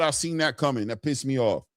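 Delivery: usual speaking voice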